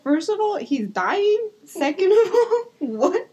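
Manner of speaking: high, laughing incredulous list; mock incredulity. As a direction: voice the baffled relatives, giggling. Co-host voices relatives' incredulity.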